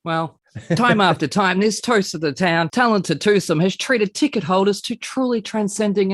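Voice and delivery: rapid, bouncy presenter patter; showbiz host intro. Acted. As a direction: reel it off like a TV host. Performs alliterative showbiz host intro.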